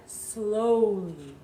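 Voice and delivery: "slowly" drawn out long; slow, lengthened "slowly"; stretched out. Shown as a demonstration. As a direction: linger on each sound of "slowly". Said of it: "stretch out the letters".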